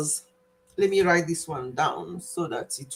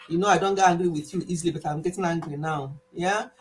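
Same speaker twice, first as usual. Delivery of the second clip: usual speaking voice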